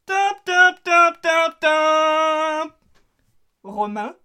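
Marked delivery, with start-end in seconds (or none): 0.1-2.7 vocal jingle. Acted. Vocal jingle for correct answer.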